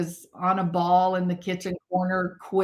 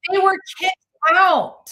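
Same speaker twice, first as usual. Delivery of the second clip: louder and higher outburst; indignant; 9 semitones higher, 7 dB louder than usual. Real, not acted. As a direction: exclaim it in indignation. Indignant exclamation.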